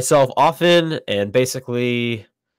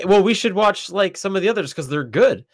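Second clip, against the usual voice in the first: mock suggestion; 8 semitones higher than usual. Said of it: "So I was like".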